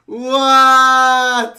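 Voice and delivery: long, high, very loud yell; shocked yell. Real, not acted. Reacting to underwater solve, shocked yell. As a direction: hold the word out at full volume.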